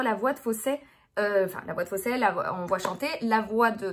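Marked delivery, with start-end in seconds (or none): none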